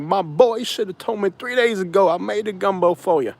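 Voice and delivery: lightly mock his friend's complaint; Louisiana friend. Friend scolds him about the gumbo, lightly voiced. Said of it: "He was like".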